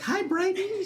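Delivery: high, bright-voiced hello to a child; cheery high greeting. Tony greeting the little girl at church, high and cheery. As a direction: pitch way up like greeting a little kid.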